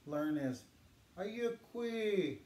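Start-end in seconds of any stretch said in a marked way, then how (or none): 1.2-2.4 slow, carefully modelled Ayukwi; teacher modelling Ayukwi, higher and drawn out; Yurok greeting. Shown as a demonstration. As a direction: say Ayukwi clearly for learners to repeat. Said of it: "Can you guys say that with me?".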